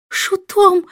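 queen crying out in outrage at a jester; shrill cry. Acted. Queen to the king: outraged high cry. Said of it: "вскричала королева".

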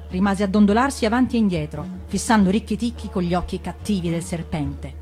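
ordinary delivery, nothing marked

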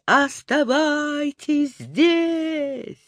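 high, drawn-out distant call; faraway sing-out from the sky; calling from high above. A narrator's reading. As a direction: stretch it out as if from far overhead. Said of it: "С высоты до него донеслось".

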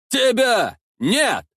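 high-pitched, punched exclamations; defiant cry. A narrator's reading. Boyar wants all Kiev to hear him, defiant cry. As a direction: cry out each word separately, pitch high then falling. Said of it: "выкрикивал боярин".

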